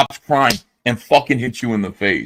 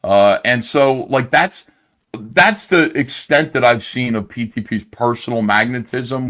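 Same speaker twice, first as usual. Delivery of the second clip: ordinary delivery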